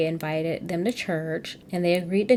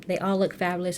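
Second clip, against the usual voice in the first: ordinary talk, nothing marked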